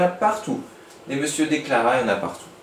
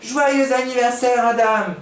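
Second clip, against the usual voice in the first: high, loud birthday cheer; shouted all together; 10 semitones higher, 4 dB louder than usual. A narrator's reading. Kids shouting happy birthday to Adam. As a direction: cheer it out as a class. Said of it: "Ils crièrent tous ensemble".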